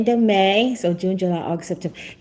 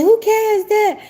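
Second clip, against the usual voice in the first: voicing her son; 11 semitones higher than usual. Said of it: "He was like".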